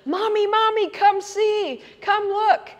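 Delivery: high, loud mimicked kids' shout; excited children calling. Children calling mother out to the woods, imitated excited high shouts. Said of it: "came running back in saying".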